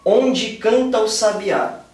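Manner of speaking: slowed verse reading with "canta o" joined as "cantu"; slow scansion reading. Shown as a demonstration. Teacher demonstrating the elision to the class, slow and deliberate. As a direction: demo the elision, unhurried. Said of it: "Quer ver, ó?".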